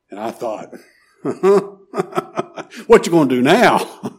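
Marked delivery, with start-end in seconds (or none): 1.2-4.1 louder, higher, amused challenge; laugh, then tease it out. Real, not acted. Preacher amused at the mother caught by her promise, laughing. Amused aside while telling story.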